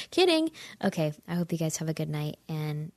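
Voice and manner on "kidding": a high, loud laughing "kidding"; squealing, laughing retraction. Real, not acted. Laughs off her own rant.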